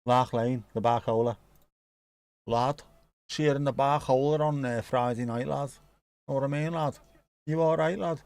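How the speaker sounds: mock Liverpool lad, Scouse accent; Scouse lad inviting a mate to the pub. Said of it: "a Scouse pub".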